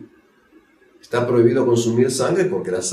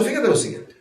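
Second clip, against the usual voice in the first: pointed retort; 14 semitones higher, faster than usual. Acted. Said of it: "Y le dice al saduceo".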